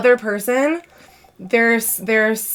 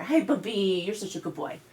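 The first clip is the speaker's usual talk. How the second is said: soft doting pet voice; 2 semitones lower, 11 dB quieter than usual. Real, not acted. Talking sweetly to the dog.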